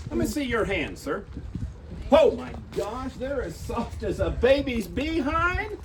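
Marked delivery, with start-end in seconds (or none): 2.1-5.8 mock surprise. Judge mocks soft hands.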